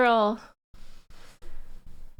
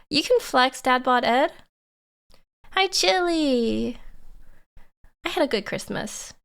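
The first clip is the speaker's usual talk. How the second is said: ordinary talk, nothing marked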